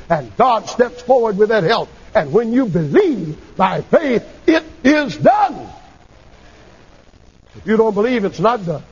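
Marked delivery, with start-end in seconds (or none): 4.5-5.7 slow, high pulpit proclamation; triumphant preacher's climax; emphatic declaration. Real, not acted. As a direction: stretch each word, pitched high.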